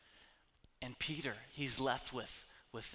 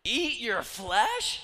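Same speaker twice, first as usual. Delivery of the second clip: high, loud, slow outraged squeal; mock disgusted listener; 8 semitones higher, 11 dB louder, much slower than usual. Fan recoiling from Jesus's words in high loud disgust.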